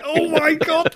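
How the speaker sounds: very high mock-horrified "oh my God"; retold horror. Friend retelling his ticklish feet, mock horror.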